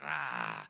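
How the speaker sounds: low, loud mock monster roar; demon growl. Dad hiding on the top bunk, growling like a demon. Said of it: "growling like a demon".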